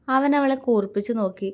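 plain reading voice

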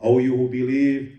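ordinary talk, nothing marked

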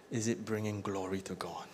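hushed, probing whisper. Real, not acted. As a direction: ask it under your breath. Pastor asks searching question softly.

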